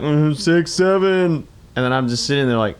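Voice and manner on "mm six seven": put-on slow, floaty free-spirit kid voice; echoing ethereal energy being. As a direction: drift the words out dreamily. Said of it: "You were using a very free spirit voice for your kids".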